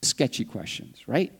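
ordinary delivery

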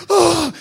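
high, panicky "ah"; mock frightened gasp. Imitating everyone scared of the hurricane, mock gasp. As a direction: gasp like a scared crowd, exaggerated. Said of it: "Está todo el mundo asustado".

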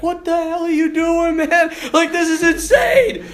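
put-on high panicked shout of a scared kid; panicked yell. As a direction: scream at the coach in fright. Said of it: "they're like".

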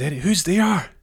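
high, loud nervous question; alarmed calling out. Acted. Injured man calls to unseen footsteps, alarmed and loud.

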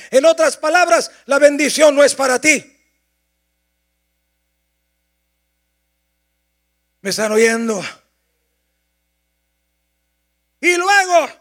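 loud, rising preacher's voice; impassioned pulpit delivery; fervent preaching. Acted. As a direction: drive the sermon home at full force. Preaching at full force.

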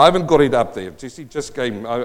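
ordinary talk

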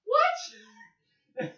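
high laughing "What?"; shocked squeak. Retold or imitated. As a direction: squeal it in disbelief. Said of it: "He's like".

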